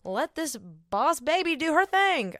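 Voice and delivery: high, sassy self-quote; playful boss attitude. Retold or imitated. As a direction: hype yourself up like a proud mom. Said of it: "And I'm just like".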